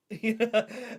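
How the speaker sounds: loud amused laugh; burst of laughter. Real, not acted. Streamer laughing at own VR joke, loud laugh.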